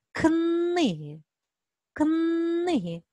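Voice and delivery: "книги" high, with the н held long after к; stretched N sound. Shown as a demonstration. Teacher gives children the next word, "книги" with a long н. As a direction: draw out the hard н in "книги". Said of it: "Який звук?".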